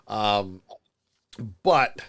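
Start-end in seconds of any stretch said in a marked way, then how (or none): 1.6-2.0 "but" set apart after a pause, punched a little louder; stress on BUT. Real, not acted. Co-host qualifies his praise of the film, stressed "but". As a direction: land hard on "but" and let it hang. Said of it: "this is where I stress the but".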